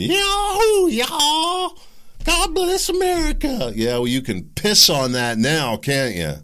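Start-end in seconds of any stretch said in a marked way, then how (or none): 0.0-3.7 high, loud, hammed-up whooping cheer; mock patriotic cheer. Mocking the Super Bowl crowd, whooping high and loud. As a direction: ham up a yee-haw crowd cheering the flag.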